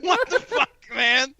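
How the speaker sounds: high-pitched, breaking into laughter; laughing quote. Retold or imitated. Host repeating a stranger's comment on cousin's post, laughing.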